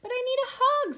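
high, loud little-bear plea; pleading. Acted. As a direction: plead insistently like a child. Douglas pleads with the rabbit.

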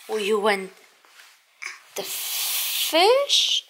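ordinary talk, nothing marked

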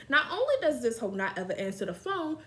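usual speaking voice

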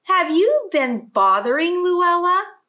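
mother confronting her daughter loudly; crying out. Acted. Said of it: "she cried".

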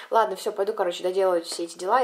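normal talking voice

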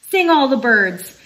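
ordinary delivery

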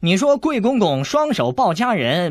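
mock-solemn 贵公公双手抱佳人; mock serious. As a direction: stress 佳人 sharply as a challenge. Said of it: "韦小宝正色道".

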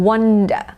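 "wonder" said as WAN-der; short "a" vowel. Shown as a demonstration. Teacher recaps the word for doubting, "wonder" with open a. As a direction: open "ah" in the first syllable of "wonder". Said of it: "เสียงสระอะ".

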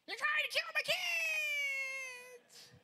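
very high, loud mock scream of the mother; high imitation of a yelling woman, dropping off at the end. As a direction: shriek it like the furious mom.

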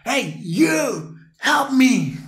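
high loud call to one person; single him out with a loud high call. Retold or imitated. Friend pointing at the narrator, calling him loudly. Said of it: "me señala".

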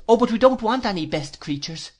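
put-on high, childish pout. A narrator's reading. Dora rejects praise of the friend, high-pitched pout. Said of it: "pouted Dora".

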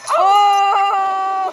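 sudden loud high "Oh!"; startled shout. Real, not acted. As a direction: yelp as the cup breaks. Reacting to the cup breaking.

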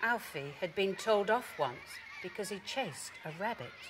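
plain reading voice

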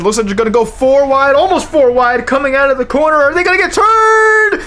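tension peaks as the cars go four wide; building excitement. Real, not acted. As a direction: caller bracing for a crash.

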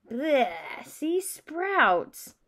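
exclaimed yuck, pitch swinging up; acted-out revulsion; disgusted. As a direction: react to a nasty taste. Acting out disgust at bad bean.

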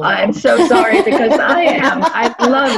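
amused, laughing protest. Real, not acted. Laughing mock apology for liking phonology.

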